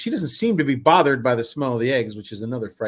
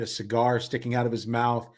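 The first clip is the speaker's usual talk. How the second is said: ordinary delivery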